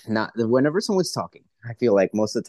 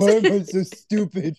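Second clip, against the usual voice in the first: high-pitched comic self-reproach; mock despair; 10 semitones higher than usual. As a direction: wail it in exaggerated, playful despair. Acts despair after botched order.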